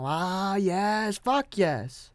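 drawn-out, high-pitched cheer; mock celebration. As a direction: celebrate like the gamble paid off. Acting out relief at no crash.

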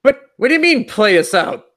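confused spouse voice. Retold or imitated.

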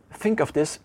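ordinary talk, nothing marked

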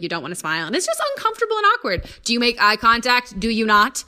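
ordinary talk, nothing marked

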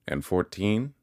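rising, prompting question on FOURTEEN; quizzing. A narrator's reading. Pumblechook springing another sum at the gate, quizzing. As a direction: lift "fourteen" high like a quiz question.

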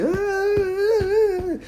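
very high sustained wailing scream; mother's mock scream. Mother screaming as the tiger bites, hammed-up shriek. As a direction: imitate a panicked shriek, held high. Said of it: "a mãe dele vai começar a gritar".

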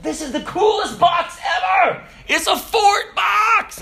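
high, loud, thrilled shout, stretching the last word; excited kid shouting. Retold or imitated. Kid discovering the toy's box, excited shout. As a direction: shout it like an overjoyed child.

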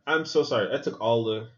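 usual speaking voice

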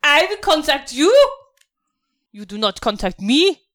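haughty officer, starting high and dropping; General Hans. Acted. General rebuffing a soldier who asked for contact, haughty. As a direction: put the underling firmly in their place.

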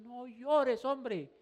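high, loud quoted angel. Retold or imitated. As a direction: cry it out as the angel. Angel's words retold again.

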